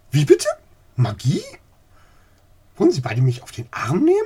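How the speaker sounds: loud, high and fast outrage; indignant disbelief. Acted. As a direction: splutter in disbelief. Old lady outraged at magic claim.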